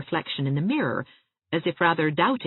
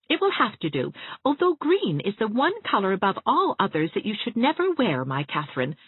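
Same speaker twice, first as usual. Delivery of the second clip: higher, bright teasing voice; cheerful friend pitched up; Anne, playfully insistent; 6 semitones higher than usual. Acted. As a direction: insist with a fond smile.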